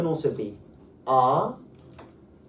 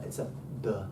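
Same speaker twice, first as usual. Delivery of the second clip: ordinary talk, nothing marked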